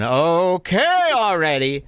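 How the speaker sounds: mock fed-up, drag it out; impatient. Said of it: "And I'm going".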